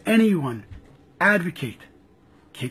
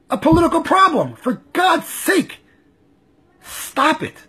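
exasperated outburst; 6 semitones higher than usual. Real, not acted. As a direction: let it burst out of you.

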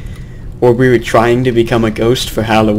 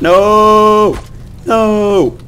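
alarmed shout; 9 semitones higher than usual. Real, not acted. Sudden in-game danger.

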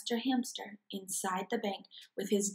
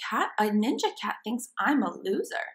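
usual speaking voice

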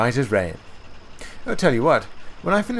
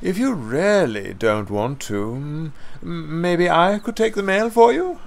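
coaxing offer, lifting high towards the end; sly; 9 semitones higher than usual. A narrator's reading. Mavis offering to take Percy's mail run, sly coaxing. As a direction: dangle the offer, hesitant and innocent. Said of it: "she said slyly".

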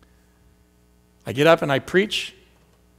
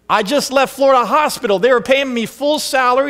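high, raised protest about the pay; indignant emphasis; 7 semitones higher, 4 dB louder than usual. Real, not acted. Pastor telling the church about losing his salary, raised and high. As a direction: push it up with disbelief.

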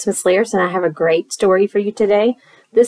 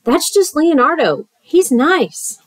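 raised, lilting storybook voice; reassuring monster character; 8 semitones higher than usual. Acted. Monster reassures the second scared kid, high lilting reassurance. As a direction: soothe with a higher, friendly lilt.

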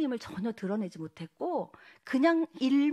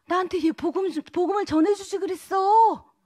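anguished cry; 8 semitones higher, 9 dB louder than usual. Retold or imitated. Friend in hell cries out.

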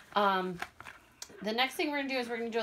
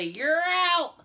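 high, loud umpire call; 8 semitones higher, 7 dB louder than usual. Retold or imitated. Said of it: "he'll say".

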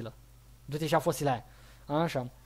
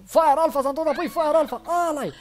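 high, loud excited cheering; hammed-up high-pitched jubilation; triumphant celebration; 7 semitones higher, 3 dB louder than usual. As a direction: hail the returning hero to the crowd. Celebrates Fire Alpha's return.

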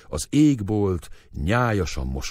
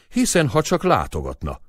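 raised, bitter exclamation; bitter outcry; 7 semitones higher, 3 dB louder than usual. A narrator's reading. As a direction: cry out with bitterness. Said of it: "kiáltott föl keserűen".

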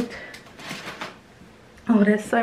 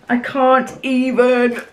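overcome with delight; 4 semitones higher, 5 dB louder, slower than usual. Real, not acted. Overcome opening stationery set.

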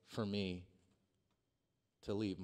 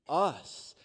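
normal talking voice

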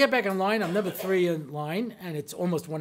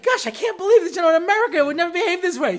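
high, loud American outrage; scandalized comic complaint; indignant; 11 semitones higher, 9 dB louder, faster than usual. Retold or imitated. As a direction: protest like an affronted newcomer. Indignant newcomer scolds the clerk.

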